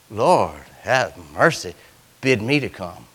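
soft, low plea; pleading softly. Retold or imitated. Peter softly pleading with the Lord to come. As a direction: beg quietly like Peter. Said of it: "He said".

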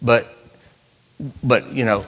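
ordinary delivery

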